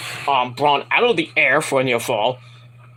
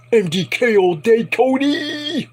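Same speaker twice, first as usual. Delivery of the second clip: high shout rising to a long squeal; mock catchphrase yell; 7 semitones higher, slower than usual. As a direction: belt it like a wrestler's war cry. Mimicking a wrestler's catchphrase shout.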